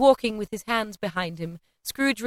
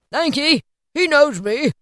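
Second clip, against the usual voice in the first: put-on high, louder old man's reply; eager, hearty thanks; genial Scrooge; 7 semitones higher, 7 dB louder than usual. A narrator's reading. Scrooge answers the maid.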